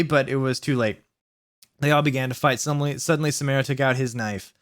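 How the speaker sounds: ordinary delivery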